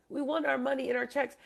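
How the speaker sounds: normal talking voice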